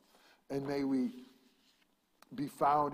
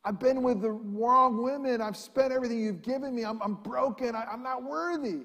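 higher pleading son's voice; repentant son; 9 semitones higher, 4 dB louder than usual. Acted. Son begging his father's forgiveness, higher pleading. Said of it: "the son wants to say".

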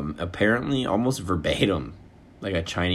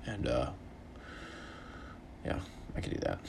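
whisper; 6 semitones lower, 15 dB quieter than usual. Real, not acted. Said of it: "whispered repeat".

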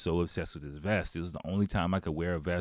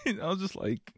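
ordinary delivery

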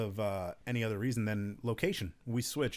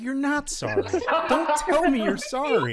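high and loud, laughing through it; laughing retort; 12 semitones higher, 7 dB louder than usual. Retold or imitated. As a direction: scold them while cracking up. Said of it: "I'm like".

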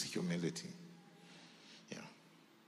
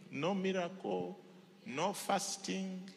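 slow drawn-out list; 7 semitones higher, slower than usual. Real, not acted. Preacher drawls list.

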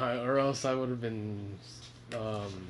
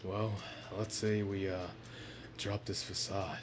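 lightly put-on anime voice, lower; Vox Akuma; 4 semitones lower, 6 dB quieter than usual. Teasing co-host about a facade, low anime voice impression. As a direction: drop into a low, hesitant anime-character voice. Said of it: "that your anime voice?".

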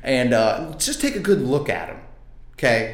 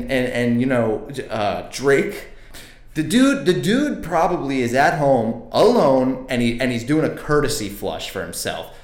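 usual speaking voice

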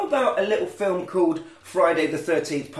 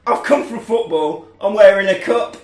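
louder, higher comeback; mock retort; 3 semitones higher, 7 dB louder than usual. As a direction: proclaim it for the laugh. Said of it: "And he goes".

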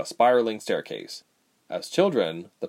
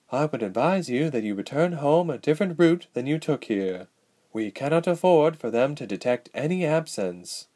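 lightly voiced elf adviser; 5 semitones higher than usual. Elf adviser warns the princess.

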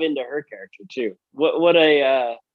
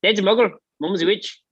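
mock Irish accent, higher, laughing; lightly hammed-up Irish schoolboy; Seamus Finnegan impression; 9 semitones higher, faster than usual. Quoting Harry Potter to a friend, mock Irish accent. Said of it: "Seamus Finnegan".

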